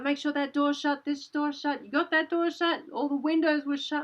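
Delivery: retold urgent orders. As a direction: imitate anxious people checking every door. Villagers urgently locking up the house.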